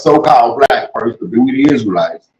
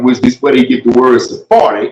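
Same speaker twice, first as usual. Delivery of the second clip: normal talking voice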